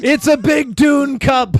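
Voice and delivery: loud, slow proud showing off; excited shout. Real, not acted. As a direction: present the cup triumphantly.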